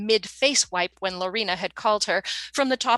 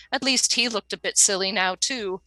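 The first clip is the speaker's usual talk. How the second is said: ordinary delivery, nothing marked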